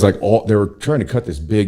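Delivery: ordinary talk, nothing marked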